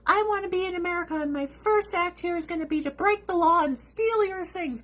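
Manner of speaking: high, quick mocking voice; high-pitched mock newcomer; mocking quote. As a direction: parody them in a silly high pitch. Mocking imagined migrants' words.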